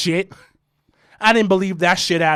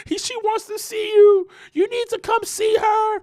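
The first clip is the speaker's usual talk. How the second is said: high mock pleading brother; crying brother. Imitating brother calling about her, high pleading. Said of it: "called me crying".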